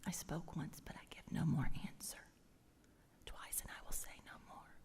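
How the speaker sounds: hushed, humbled whisper; humble whisper. Acted. Job falling silent before God, hushed whisper. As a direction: whisper with the hand over the mouth. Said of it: "I put my hand over my mouth".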